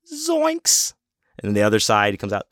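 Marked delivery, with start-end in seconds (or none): 0.1-0.9 very high cartoon yelp; Shaggy. Imitating an actor peeking round a banner, high cartoon voice. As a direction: squeal it like a spooked cartoon character. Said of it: "he's doing the Shaggy voice".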